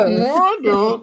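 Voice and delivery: muffled from inside a sleeve. Acted. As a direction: speak as if muffled under cloth. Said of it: "a muffled voice from inside the sleeve".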